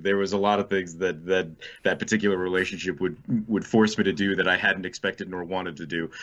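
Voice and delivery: ordinary delivery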